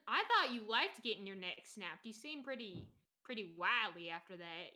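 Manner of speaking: ordinary talk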